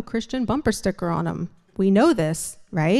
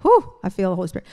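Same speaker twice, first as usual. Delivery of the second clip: ordinary delivery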